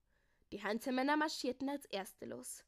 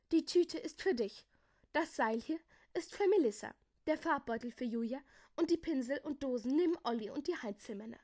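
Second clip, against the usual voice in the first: raised, energetic voice, easing down; giving orders; 4 semitones higher, 5 dB louder than usual. A narrator's reading. Jakobus hands out the items to his friends, giving orders. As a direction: assign each item briskly to the group.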